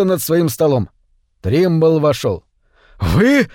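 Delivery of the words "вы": roar. A narrator's reading. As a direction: bellow in outrage. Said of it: "взревел директор".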